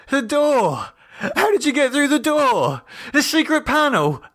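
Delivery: high, frantic questioning; panicked disbelief. A narrator's reading. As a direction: demand it in panic. David disbelieving.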